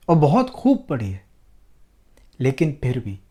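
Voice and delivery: normal talking voice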